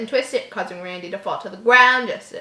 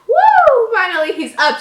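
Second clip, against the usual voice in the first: much higher and louder whoop; gleeful whoop; 11 semitones higher, 11 dB louder than usual. Acted. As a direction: whoop like a thrilled bully. Randy cheering as Jeff stands.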